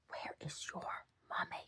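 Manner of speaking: whispered. Retold or imitated. Whispered retelling.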